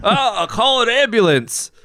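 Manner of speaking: laughing quote. Pretend. Friend quotes the Hitman joke while laughing. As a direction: giggle the line out. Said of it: "Hitman line joke".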